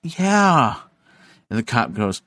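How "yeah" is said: mocking. Narrator mocking friend's denial.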